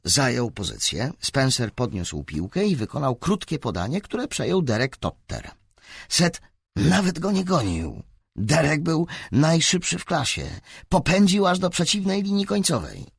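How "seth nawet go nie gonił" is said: ordinary delivery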